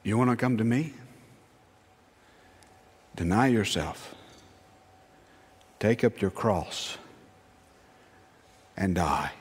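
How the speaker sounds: low voice, long weighty pauses; Jesus, solemn call. Acted. Jesus calls followers to deny themselves, low and solemn.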